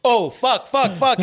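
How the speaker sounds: high-pitched retold panic; mock panic. SWAT team coming in on camera, high mock panic. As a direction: act out alarm building in quick repeated curses.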